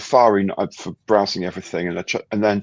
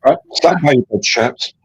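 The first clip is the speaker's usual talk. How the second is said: posh voice; 6 semitones higher, 9 dB louder than usual. Pretend. Greeting in posh banter.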